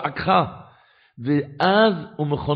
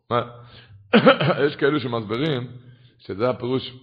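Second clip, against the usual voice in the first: usual speaking voice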